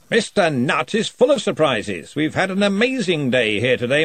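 jovial lordly wizard; booming Arch-Chancellor. A narrator's reading. Arch-Chancellor tells the story.

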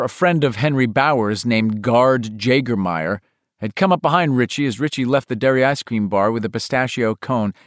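plain reading voice

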